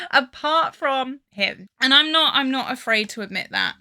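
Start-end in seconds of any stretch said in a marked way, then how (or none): 0.0-1.7 laughing. Real, not acted.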